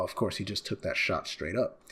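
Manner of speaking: ordinary delivery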